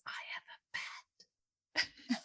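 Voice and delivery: secretive slow whisper. Retold or imitated. Little girl whispering to her teacher about her pet. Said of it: "and says".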